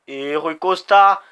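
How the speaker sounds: usual speaking voice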